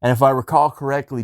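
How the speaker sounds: ordinary talk, nothing marked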